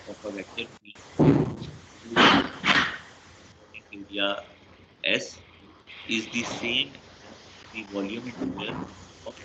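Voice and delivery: choppy dropping call audio; bad connection, words breaking up; voice cutting out. Real, not acted. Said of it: "sir, आपकी आवाज़ cut रही है".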